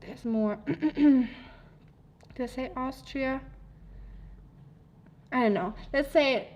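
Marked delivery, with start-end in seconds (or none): none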